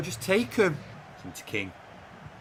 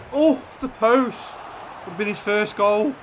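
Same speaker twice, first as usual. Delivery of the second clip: dismayed groan; 7 semitones higher than usual. Real, not acted. Shot hits the post.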